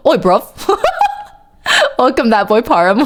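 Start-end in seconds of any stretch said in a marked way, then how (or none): 0.1-0.4 mock British slang call; jokey put-on British, about to laugh; playful British lad accent; fake British accent. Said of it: "middle of a fake British accent".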